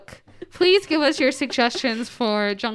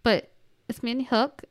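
usual speaking voice

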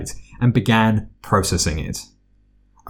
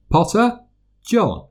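calling out; 11 semitones higher than usual. A narrator's reading. Calling a name in class.

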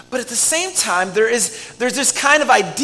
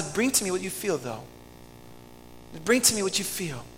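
soft invitation; 9 dB quieter than usual. Acted. God's invitation voiced softly.

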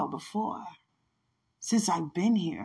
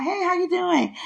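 cheery teacher greeting; 12 semitones higher, 4 dB louder than usual. Retold or imitated. Teacher greets her in the aisle.

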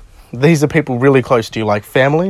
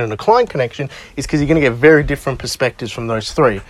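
ordinary talk

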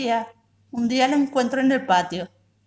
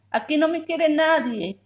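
put-on child's cry; 6 semitones higher than usual. A narrator's reading. Child's tantrum, shouting she's unloved.

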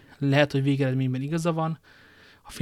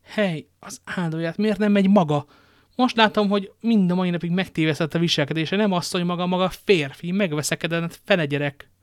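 angry scolding; 5 semitones higher, 5 dB louder than usual. Acted. Wife scolds cowardly husband.

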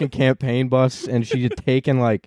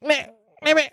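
put-on much higher, strained old Bernie voice; Bernie impression; 14 semitones higher than usual. Comic cranking his Bernie voice higher for laughs. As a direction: squeeze it out like a cranky old man. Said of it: "that voice".

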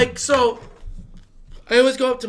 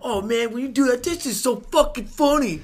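mocking parrot; 4 semitones higher, 5 dB louder than usual. Mockingly parrots the co-host's praise.

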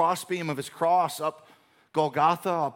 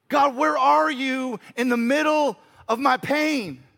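high, loud, slow pained plea; anguished cry; 7 semitones higher, 6 dB louder than usual. Acted. A hurting believer in pain begs God, slow anguished plea. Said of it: "When you're crying out".

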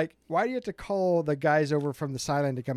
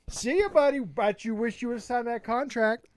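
mocking taunt; 6 semitones higher than usual. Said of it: "you know what I did on Sunday".

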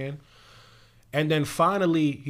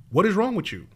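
higher, louder quoted demand; mildly dramatized shout, pitched up; read-out shout; 7 semitones higher, 5 dB louder than usual. A narrator's reading. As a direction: repeat the coach's question with more force. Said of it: "and shouted".

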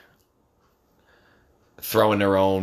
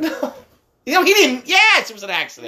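exasperated shout; 5 dB louder than usual. Real, not acted. Heated argument with son.

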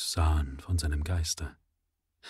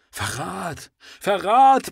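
building to a high, loud roar; 10 semitones higher, 7 dB louder, much slower than usual. Acted. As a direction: roar the second Verrat. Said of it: "Das kann besser interpretiert werden".

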